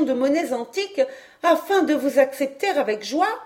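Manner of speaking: jeune fille enjouée, voix plus haute; un reproche mutin, mi-sérieux; Hélène taquine. Acted. Hélène fait un reproche tendre. (Hélène taquine Patrice, voix haute et espiègle)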